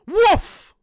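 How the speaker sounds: sudden loud barked woof. Real, not acted. Vlogger barking at the news, loud woof. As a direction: bark it out like a hype dog. Wrestling vlogger's signature bark.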